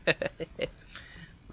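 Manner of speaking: slow, high laughing question. Real, not acted. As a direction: laugh through the words. Laughing at monitor joke.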